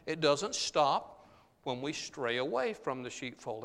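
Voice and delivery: ordinary talk, nothing marked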